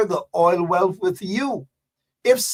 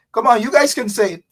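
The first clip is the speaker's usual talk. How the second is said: ordinary talk, nothing marked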